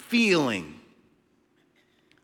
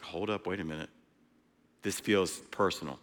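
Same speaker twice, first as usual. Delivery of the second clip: sudden hushed realization; 8 semitones lower, 11 dB quieter than usual. Retold or imitated.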